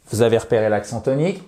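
ordinary talk

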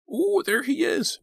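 put-on very high, thrilled child voice; excited exclamation. A narrator's reading. Little brother spots Santa, exclaiming excitedly. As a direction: squeal it slowly in delight. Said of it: "Michael exclaimed".

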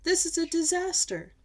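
high, loud storybook dismay; royal panic. A narrator's reading. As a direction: cry out in hammed-up alarm. Royals panic over lost money.

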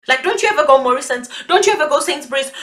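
ordinary delivery

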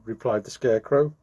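ordinary delivery

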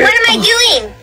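ordinary talk

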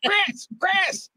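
high-pitched re-enacted call; urgent calling out. Urgently calling someone while live.

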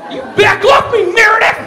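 hammed-up enraged shout; furious warning. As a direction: yell her away in a rage. Acted quarrel escalating.

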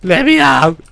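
loud, high cry to be let out; frightened shouting plea; scared pleading. Real, not acted. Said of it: "I'm scared".

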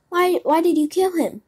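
high, stammered demand; dismayed questioning. A narrator's reading. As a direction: ask in distress, pitched well up. Puppet confronts the killer cat.

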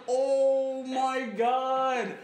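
very slow, drawn-out oh my God; long exasperated groan of oh my God. Retold or imitated. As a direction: stretch it out in disbelief. Exasperated at counselor's mix-up.

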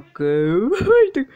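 usual speaking voice

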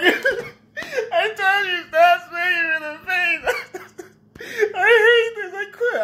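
high, slow whine broken by laughter; fake-cry through laughter; laughing mock whine. Hit in the face by his partner, a high laughing mock cry. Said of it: "I was like".

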